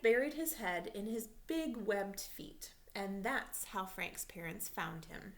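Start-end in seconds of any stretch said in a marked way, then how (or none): none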